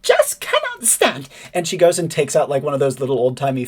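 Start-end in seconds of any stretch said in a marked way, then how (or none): 0.0-1.3 very high, slow, shocked chirp; high slow scandalised bird voice; horrified bird lady. Acted. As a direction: gasp it out, scandalised. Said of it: "He says".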